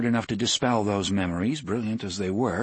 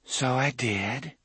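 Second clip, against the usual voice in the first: dreaming voice; 5 semitones higher, slower than usual. A narrator's reading. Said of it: "That same dreaming voice".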